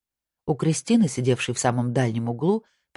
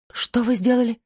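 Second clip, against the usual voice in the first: high, shocked female outcry; exclaimed; 10 semitones higher than usual. A narrator's reading. Wife hears of a hidden camera, exclaiming. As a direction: burst out in disbelief, pitched up. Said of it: "воскликнула Кристина".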